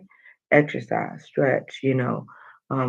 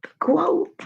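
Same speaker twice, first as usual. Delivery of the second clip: much higher, squeaky cry; squeaky exclamation. Real, not acted. Host remembering the quote, squeaky mock panic. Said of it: "I forgot, nobody reminded me".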